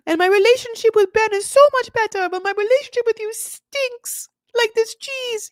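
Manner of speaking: mock the wife whining at her husband; shrill whiny wife. Mocking the wife yelling at her husband in a high whine. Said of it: "She's like".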